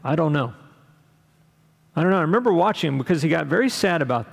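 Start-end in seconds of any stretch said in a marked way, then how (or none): 0.0-2.2 understated retold sadness; mildly sad. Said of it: "I remember he said sadly".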